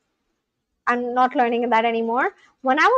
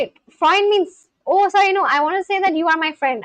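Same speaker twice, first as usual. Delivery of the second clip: ordinary delivery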